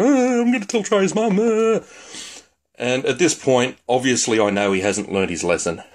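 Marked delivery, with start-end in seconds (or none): 0.0-1.8 high mock-whiny troll voice; squeaky, hammed-up bellyaching; whinging little troll. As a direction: whine it like a tattling brat. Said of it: "gonna fucking whinge and bitch and carry on".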